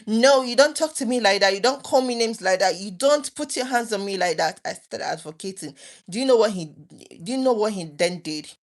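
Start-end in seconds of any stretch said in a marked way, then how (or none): 0.1-4.6 higher, louder, laying down the law; standing up to him at last; firm pushback. Retold or imitated. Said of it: "I started saying".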